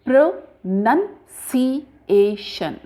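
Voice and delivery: "Pronunciation" very slowly as pro-nun-si-a-shun; syllable-by-syllable pronunciation. Shown as a demonstration. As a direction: sound out "Pronunciation" unit by unit, with NUN in the second. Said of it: "pronounce the word in a correct way".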